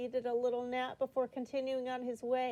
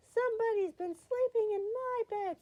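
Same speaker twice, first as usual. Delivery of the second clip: high, loud middle-sized bear voice; storybook mother bear, raised voice; Mama Bear; 10 semitones higher, 6 dB louder than usual. A narrator's reading. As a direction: pitch it well up, complaining loudly. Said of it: "in her middle-sized voice".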